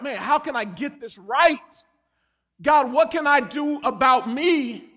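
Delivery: loud, slow, pleading cry to God; imagined desperate plea to God; pleading prayer. Retold or imitated. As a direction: beg God for an answer, drawn out.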